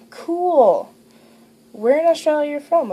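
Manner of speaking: high, loud imitation of an impressed caller; playfully retold enthusiasm; mock impressed excitement. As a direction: act out an eager, impressed stranger. Acting out Skype guy's impressed reply.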